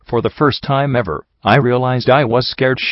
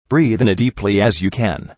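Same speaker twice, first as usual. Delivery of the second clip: ordinary delivery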